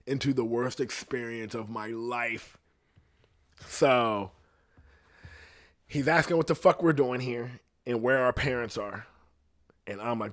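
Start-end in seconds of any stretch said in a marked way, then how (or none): none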